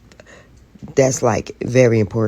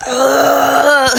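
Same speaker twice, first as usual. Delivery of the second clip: crying uh; 8 semitones higher, 7 dB louder than usual. Real, not acted. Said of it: "having a little meltdown".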